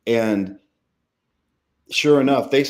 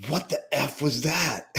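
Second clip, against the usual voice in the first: stunned; 3 semitones higher, 6 dB quieter than usual. Retold or imitated. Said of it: "the cameraman's a skeptic".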